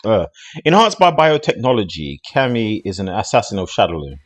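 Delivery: read-aloud character bio. Acted. Streamer reading Cammy's bio to viewers, projected read-aloud.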